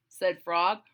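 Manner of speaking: ordinary delivery, nothing marked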